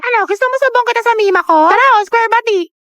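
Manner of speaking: taunting. Acted. Picking a fight, taunting.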